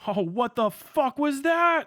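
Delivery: high, loud, slow amazed outburst; startled exclamation. Real, not acted. Watching ship video, sudden surprised outcry. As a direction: blurt it out in real shock.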